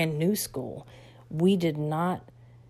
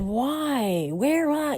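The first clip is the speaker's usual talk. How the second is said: high, shocked why and where; upset protest; 9 semitones higher, much slower than usual. Retold or imitated. Daughter protesting the sudden move, high and stunned. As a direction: push back, stunned.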